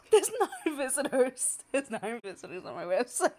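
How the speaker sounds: high, loud, laughing disbelief; giddy laugh-cry; real overwhelmed joy; laughing through tears. Real, not acted. Overwhelmed by visitors.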